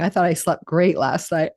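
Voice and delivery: normal talking voice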